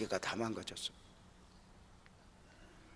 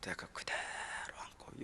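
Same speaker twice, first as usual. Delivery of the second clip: choked up; 11 dB quieter, slower than usual. Real, not acted. Finding collapsed wife.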